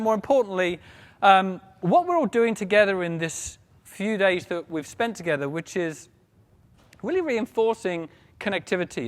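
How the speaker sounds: normal talking voice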